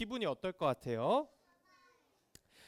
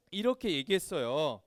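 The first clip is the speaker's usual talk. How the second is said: ordinary talk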